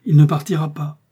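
high, loud, emphatic assurance; resolute promise. A narrator's reading. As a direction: vow it with full conviction. Vows the duke won't leave.

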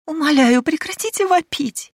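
very high, loud begging; pleading cry. Acted. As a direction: plead with hands over ears. Servant begs her to stop.

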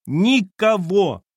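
high, loud and slow; shout. A narrator's reading. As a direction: drag the word out in a cry. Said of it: "опять крикнула она". (громко и протяжно)

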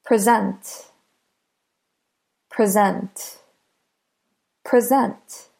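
"Present" as the verb, pre-ZENT; stress on last syllable. Shown as a demonstration. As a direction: say "Present" with the beat on SENT. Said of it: "Listen to which syllable is stressed".

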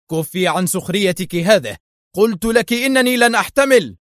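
exasperated cry rising high; furious outburst. A narrator's reading. Husband loses patience with mocking wife, furious. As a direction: snap at her, pitch soaring. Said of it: "فصاح في وجهها محنقا".

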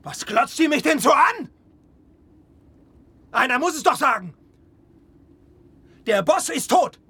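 shouting that the boss is dead; angry outburst. Acted. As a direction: throw the truth in their faces. Breaks down before the crew.

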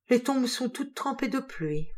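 slow, high, tired sigh of a line; drained after the cemetery walk; weary. A narrator's reading. Out-of-breath seamstress telling her employer, weary. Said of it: "d'un air las".

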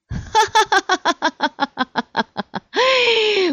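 high, loud cackling peal; peal of laughter. A narrator's reading. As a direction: burst out in a long, high cackle. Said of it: "залилась хохотом".

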